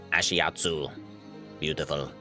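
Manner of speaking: ordinary delivery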